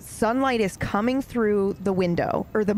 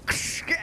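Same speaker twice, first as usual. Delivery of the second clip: sharp, voiceless crashing noise; mouth-made shattering sound effect; imitated glass shatter; 4 dB quieter than usual. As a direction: make the hissy crunch of glass smashing. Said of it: "full on just".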